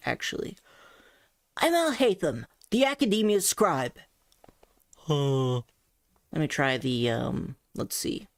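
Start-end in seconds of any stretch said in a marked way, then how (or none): 1.6-4.0 pitched up, open and ringing; high open voice. Shown as a demonstration. Coach retries the character line, high with open resonance. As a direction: keep it high but open. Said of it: "do an open resonance with a high pitch".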